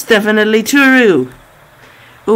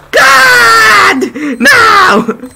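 anguished shout; 5 dB louder than usual. Real, not acted. Sudden in-game death.

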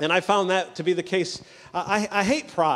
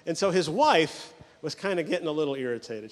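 ordinary talk

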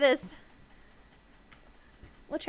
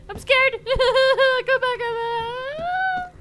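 high, wailing call for help; mock panicked plea; 8 semitones higher, 3 dB louder than usual. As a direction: cry out after someone in playful panic. Pleads after someone in game.

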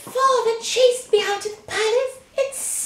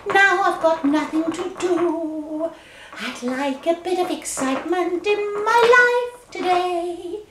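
storybook princess moaning with boredom; 4 semitones lower than usual. Acted. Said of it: "I'm so bored".

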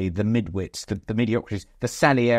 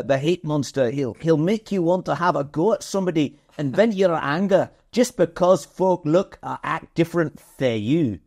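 put-on a rough Glaswegian accent quoting the hate monster ad, a touch higher; attempted Glaswegian accent; 5 semitones higher than usual. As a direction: have a go at a Glaswegian accent you admit you can't do. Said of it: "I can't really do the accent".

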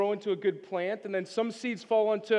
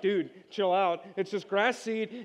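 mock chill; 2 semitones lower, slower than usual. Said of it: "And I'm like".

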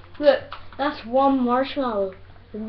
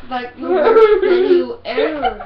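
laughing; 5 semitones higher, 13 dB louder than usual. Real, not acted. Laughing with mouth full.

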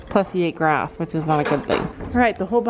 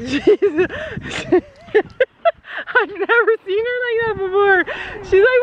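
laughing; 11 semitones higher than usual. Real, not acted. Laughing about excited horse.

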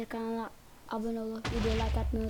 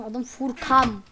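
"Furkan" said slow and loud; spelled out clearly; 5 dB louder, much slower than usual. Shown as a demonstration. Telling viewers his gamer name, slow and loud. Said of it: "N ile değil M ile".

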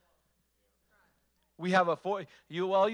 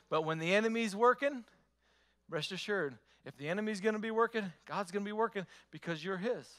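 ordinary talk

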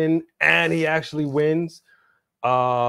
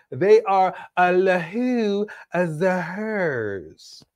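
drawn-out punchline; 5 semitones higher, much slower than usual. Pretend. Mock drawn-out punchline about tag team.

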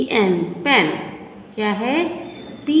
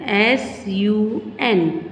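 slow spelling; much slower than usual. Shown as a demonstration. Teacher spells word slowly.

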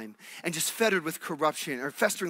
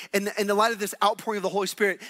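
normal talking voice

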